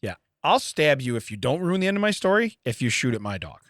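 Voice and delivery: normal talking voice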